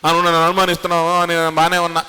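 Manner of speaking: fast, high, whiny pretend sob; mock weepy complaint; mock crying. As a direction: play the hurt sulker, half-hearted. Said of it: "అని చెప్పి ఏడవలా".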